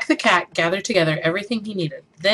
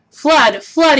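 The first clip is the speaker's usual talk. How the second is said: loud high shout of alarm; 8 semitones higher, 7 dB louder than usual. A narrator's reading. Jack yells at the birds to trick them off. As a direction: yell it to scare the birds off. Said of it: "he cried".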